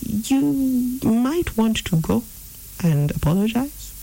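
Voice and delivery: slow, high-pitched gentle nudge. Acted. Holy Spirit nudging you to make amends, gentle. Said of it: "and his voice is very gentle".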